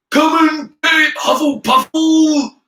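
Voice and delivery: high, loud, drawn-out jeer; shouted taunt. A narrator's reading. Goyle taunting Hufflepuff from the air, shouting. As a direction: holler it as a taunt. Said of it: "shouted Mister Goyle".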